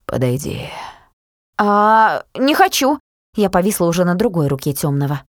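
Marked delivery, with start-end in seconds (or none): 0.0-0.7 low command. A narrator's reading. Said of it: "прозвучал приказ". 1.6-3.0 high, loud petulant whine; childish whiny refusal. A narrator's reading. Girl refuses, acting like a fool.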